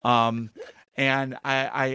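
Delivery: normal talking voice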